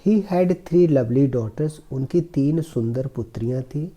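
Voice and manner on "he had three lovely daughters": slow, careful reading of the English passage; slow reading aloud. Shown as a demonstration. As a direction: read each word slowly for the class. Teacher reads story slowly. (धीमी आवाज़ में अंग्रेज़ी पढ़ना)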